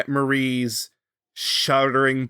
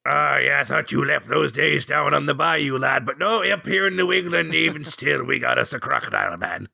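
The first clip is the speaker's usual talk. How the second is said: lightly voiced grizzled Scot, hearty; old Scottish hunter; 5 semitones higher than usual. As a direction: play the gruff old mentor with a wink.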